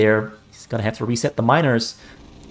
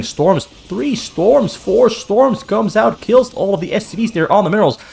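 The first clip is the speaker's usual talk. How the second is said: excited caster; 8 semitones higher, 4 dB louder than usual. Real, not acted. Big drop hits the mineral line.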